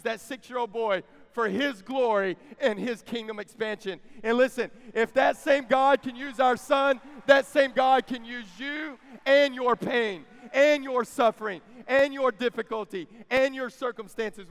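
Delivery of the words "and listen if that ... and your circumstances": loud, emphatic preacher hammering each item; full-force preaching. Real, not acted. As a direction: drive it home to the congregation, loud and raised.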